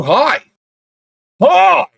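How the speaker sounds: comically high, loud "hi"; over-the-top squeaky-high greeting; hammed-up hi. Mock hi contest, escalating.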